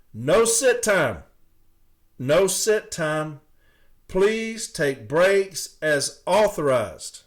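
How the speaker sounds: slow, loud, pointed reading of the rules; read-out emphasis. Shown as a demonstration. Carrier reading the heat memo aloud, slow and pointed. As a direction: spell each rule out slowly, stressing authorized. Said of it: "putting an emphasis on authorized".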